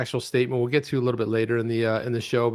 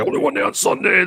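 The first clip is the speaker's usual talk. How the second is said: monster truck ad; 6 semitones higher, 6 dB louder than usual. Retold or imitated. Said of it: "Remember those stupid commercials".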